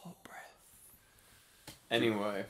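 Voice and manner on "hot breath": very soft, voiceless breath on the mic; mock-intimate whisper; breathy murmur. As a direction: breathe it right into the microphone. Joking close on the mic.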